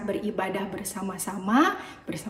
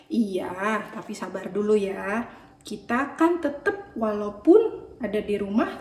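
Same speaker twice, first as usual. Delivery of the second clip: ordinary delivery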